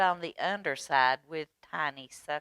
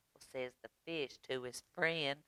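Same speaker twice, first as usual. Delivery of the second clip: ordinary delivery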